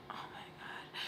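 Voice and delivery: barely audible whisper; whispered exasperation. Retold or imitated. Girl lying in wet clothes to herself, exasperated whisper. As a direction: whisper it privately, under the breath. Muttered private reaction to being wet.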